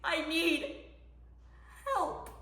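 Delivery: soft, broken-off plea after shouting; helpless plea. Acted. Mother left without anyone to help, helpless. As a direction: let the cry sink to a small plea.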